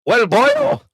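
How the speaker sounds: high, loud put-on Scottish; Scottish accent. Comedian correcting his wrong accent, high Scottish accent. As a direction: call out in a high comic Scottish voice. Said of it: "was he Scottish or".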